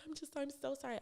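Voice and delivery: very high, soft imitation of a tearful boss; mock tearful apology. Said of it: "she started crying".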